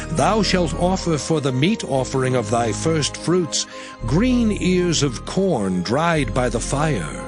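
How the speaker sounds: ordinary delivery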